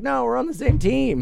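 friendly and easygoing; imitating Southerners. Southerners brushing off the worry, cheerful. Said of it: "they're like".

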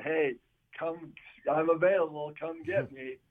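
ordinary talk, nothing marked

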